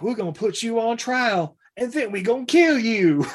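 high put-on kid voice; hammed-up vengeful kid; threatening kid. Retold kid sentences captain.

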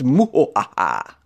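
slow, drawn-out villain cackle; playful spooky "muhoaha" laugh; hammed-up evil laugh; mock evil laugh.

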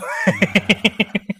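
laughter rolling on; laughing. Real, not acted. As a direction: keep laughing. Still laughing.

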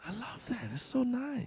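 high, soft mock Chinese accent; quietly delighted Chinese-accent caricature. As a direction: coo it in a put-on Chinese accent. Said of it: "we do such a racist Chinese guy".

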